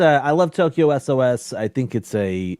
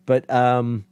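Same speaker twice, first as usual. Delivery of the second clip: usual speaking voice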